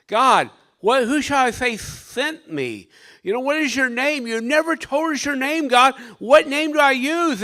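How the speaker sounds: high, urgent plea to God; crying out. Acted. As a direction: act out Moses calling up in desperation. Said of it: "he's crying out and he's saying".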